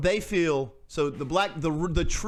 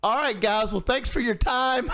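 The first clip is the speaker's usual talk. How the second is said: mock awkward interviewer; 7 semitones higher, 3 dB louder than usual. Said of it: "he's like".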